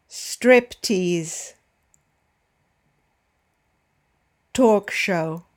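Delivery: each compound word with the first syllable punched; stress on first word. Shown as a demonstration. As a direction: hit the first syllable of "Bestseller", "Big bang", "Box office" clearly. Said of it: "Notice the stress is always on the first syllable".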